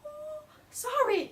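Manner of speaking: tiny, high, drawn-out mock whimper; meek apology. Student apologizing to the scolding yogi, high mock whimper.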